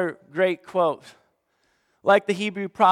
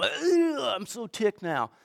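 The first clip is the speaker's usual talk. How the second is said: mock irritated groan; 4 semitones higher, 5 dB quieter, slower than usual. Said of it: "the word makes you go".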